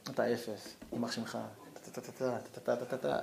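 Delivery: soft, low grumbling of insults; quiet, grumbling mock curse; muttered curses. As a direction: curse him under your breath.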